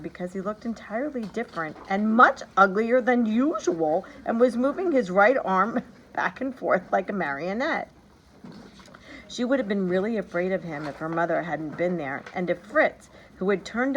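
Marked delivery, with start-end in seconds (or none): none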